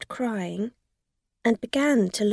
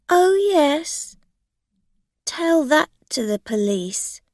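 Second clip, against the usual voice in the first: sarcastic; 8 semitones higher, 7 dB louder than usual. A narrator's reading. Rosa scoffing.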